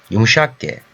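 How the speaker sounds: normal talking voice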